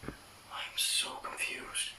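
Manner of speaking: uneasy slow whisper; whisper, spooked in the dark. Real, not acted. Whispering alone in dark house.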